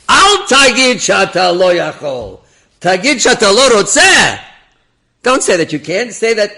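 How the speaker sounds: high, drawn-out declaiming shout; loud scolding. Retold or imitated. Rabbi corrects the officer's excuse, loud scolding. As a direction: correct him at full volume, pitched high.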